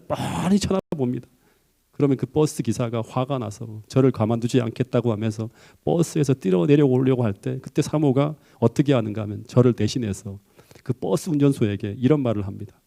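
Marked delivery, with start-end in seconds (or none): none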